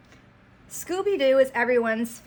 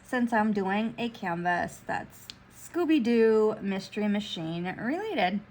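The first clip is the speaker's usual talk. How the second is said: ordinary talk, nothing marked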